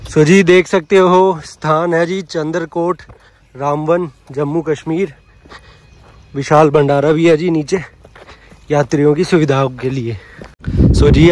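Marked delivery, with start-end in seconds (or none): none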